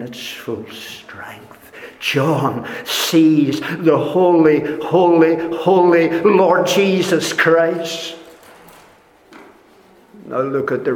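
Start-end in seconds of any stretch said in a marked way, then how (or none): 0.0-1.5 awed whisper. Acted. Preacher hushes to a whisper for Christ's face, awed whisper. 2.0-8.1 rising awe. Acted. Building on thrice holy.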